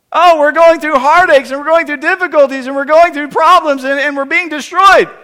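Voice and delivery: high, loud imitation of people lamenting; mock crying out. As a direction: wail out a hammed-up complaint. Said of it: "they're crying out".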